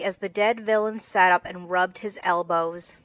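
ordinary delivery

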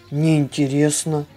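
ordinary delivery

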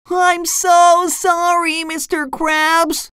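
very high, drawn-out mock sobbing; SpongeBob. A narrator's reading. Fry cook begging his boss's forgiveness, cartoon sobbing. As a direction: wail a tearful cartoon apology, slowly. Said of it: "SpongeBob sobbed".

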